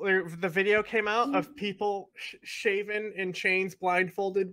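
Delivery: ordinary delivery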